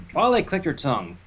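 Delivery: ordinary delivery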